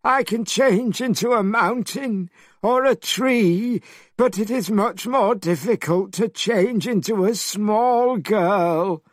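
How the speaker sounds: high whiny pig voice; Pigsy complaining. A narrator's reading. Pigsy complaining to Monkey about changing shape, high and whiny. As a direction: whine it like a lazy pig.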